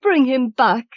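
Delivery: high, loud pleading cry; old woman crying out to her husband; desperate cry. A narrator's reading. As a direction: cry out, begging him. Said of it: "cried the old woman".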